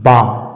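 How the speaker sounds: बाम said as "baam", the l silent; correct pronunciation. Shown as a demonstration. Teacher to class showing silent l, slow clear बाम. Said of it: "इसका सही उच्चारण होगा बाम".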